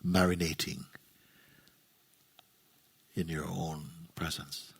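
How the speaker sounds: low, soft voice with long silences between phrases; hushed, spacious meditation leading; guided meditation. Real, not acted. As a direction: guide them gently into stillness, phrase by phrase. Leads a meditation.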